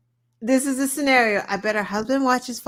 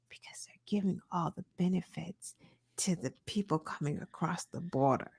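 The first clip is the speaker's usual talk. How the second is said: mock conspiratorial whisper; 3 semitones lower, 11 dB quieter than usual. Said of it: "she's like".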